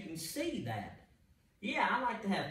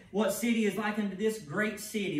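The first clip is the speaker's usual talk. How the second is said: proclaiming scripture; 5 semitones higher, 6 dB louder than usual. Acted. Preacher proclaims the verse.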